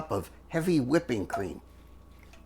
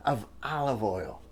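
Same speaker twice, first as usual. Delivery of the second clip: whispering; 5 semitones lower, 4 dB quieter than usual. Real, not acted. Whispered aside.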